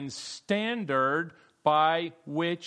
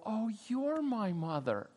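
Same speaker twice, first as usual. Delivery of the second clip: storybook reveal; 6 semitones higher, 6 dB quieter, slower than usual. Retold or imitated. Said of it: "they finally go".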